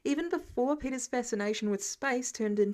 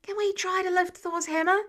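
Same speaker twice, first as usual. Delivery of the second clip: higher, louder eager question; excited classmate; 7 semitones higher, 4 dB louder than usual. A narrator's reading. A classmate eagerly asking Thor. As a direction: blurt it out thrilled. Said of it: "classmates gasped".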